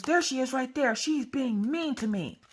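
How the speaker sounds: put-on a little kid tattling to his big sister; little boy whining that the lady is mean; whiny little boy.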